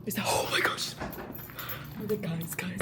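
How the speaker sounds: an excited whisper of disbelief. Real, not acted. Spotting her friend arriving, whispering in excitement. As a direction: breathe it out so she won't hear.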